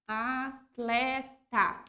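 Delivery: "Atleta" very slow, T and L sounded; slow careful word. Shown as a demonstration. Teacher modelling the written word. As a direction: stretch "Atleta" syllable by syllable. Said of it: "aqui na palavra atleta".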